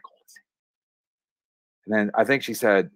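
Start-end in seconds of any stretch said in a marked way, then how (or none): 0.0-0.5 barely audible, voiceless whisper; mouthed whisper. Retold or imitated. Mom silently urging son at the deathbed, mouthed whisper. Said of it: "she's, like, mouthing".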